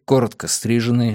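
usual speaking voice

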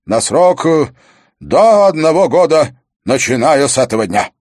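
loud, ceremonial royal decree; stern king passing sentence. Acted. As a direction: proclaim it to the hall, phrase by phrase.

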